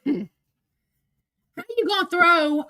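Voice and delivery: slow, high, drawn-out how you gonna throw; hurt disbelief. Real, not acted. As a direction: stretch each word in pained disbelief.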